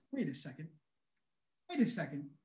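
low, soft and puzzled; hushed double take. Acted. Host stopping mid-sentence at a strange fry, hushed low aside. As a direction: drop right down as if noticing something. Host pretends to notice something odd.

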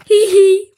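high, loud "hee hee"; mischievous giggle. A narrator's reading. Flea plotting hot cookies for Willy, giggling. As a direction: giggle over a secret prank.

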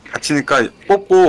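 loud, slow urgent call; calling out slowly. Real, not acted. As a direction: shout the instruction slowly. Raid leader calling out to party.

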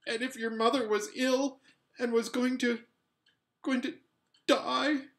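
high, rising, near tears; pleading voice nearly breaking; upset. A narrator's reading. Boy pouring out his fear to Polly, upset. Boy wound up about dying mother.

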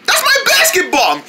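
high-pitched indignant exclamation; outraged discovery. Acted. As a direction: cry out that it's yours. Discovers his ball was swallowed.

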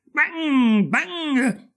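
high, ringing mock explosions; firecracker bangs. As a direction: imitate firecrackers going off in reply. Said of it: "risposero i mortaretti".

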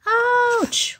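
high sudden ouch; sharp cry of pain; pained yelp. Acted. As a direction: yelp as if bitten. Character cries out in pain.

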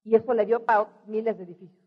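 normal talking voice

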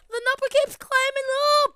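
cheering the count on; excited. Real, not acted. Crowing as the count rises.

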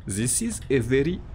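slow, deliberate Russian-accented English; careful attempt at a Russian accent. Shown as a demonstration. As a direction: draw out a mock Russian accent. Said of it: "en anglais avec accent russe".

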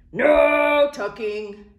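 high, loud, slow chant; crew crying out. Acted. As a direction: cry it out like a united crew. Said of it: "the crew cried".

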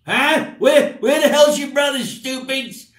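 put-on high, loud gruff old uncle barking at the kids; Uncle Tommy. Uncle yelling at kids about their brother, high and loud. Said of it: "look, look".

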